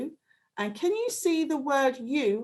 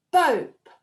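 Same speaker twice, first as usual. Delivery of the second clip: ordinary delivery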